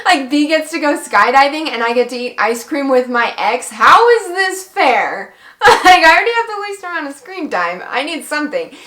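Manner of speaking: loud, high-pitched mad complaint; indignant outburst. Retold or imitated. Complaining about an ice cream date with the ex, indignant outburst. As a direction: protest the unfairness, building to a wail on "fair". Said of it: "I was so mad".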